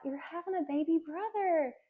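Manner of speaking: high-pitched retold big reveal; mock gender reveal. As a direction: lightly imitate someone breaking happy news to a little kid.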